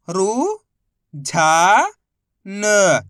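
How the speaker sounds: "रु", "झा", "न" sounded out separately, each held long; अक्षर-दर-अक्षर उच्चारण. Shown as a demonstration. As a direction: teach each syllable alone: drawn-out "रु", loud "झा", then "न". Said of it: "एक अक्षर का उच्चारण सही से करना है". ("रु", "झा", "न" को अलग-अलग खींचकर बोलना)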